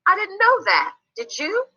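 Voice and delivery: lightly voiced curious child; mildly surprised. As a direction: share a surprising fact and check with a friend. Child sharing a surprising fact.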